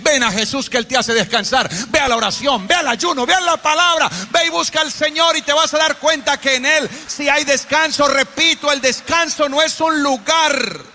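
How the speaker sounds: high, driving sermon voice; fervent preaching. Real, not acted. Preacher urging tired congregation to seek Jesus, fervent preaching.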